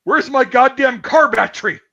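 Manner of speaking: put-on loud, high furious yell at a kid; retold angry yell. Great uncle bursting into the ice house, yelling at the boys. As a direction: bellow it like an enraged great uncle. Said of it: "yells at me and goes".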